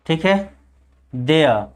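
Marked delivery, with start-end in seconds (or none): 1.1-1.6 देयर as de-a, r left out; silent r. Shown as a demonstration. Teacher confirming the pronunciation, देयर with silent r. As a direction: finish देयर on a falling schwa. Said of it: "इसको pronunciation क्या करते हैं".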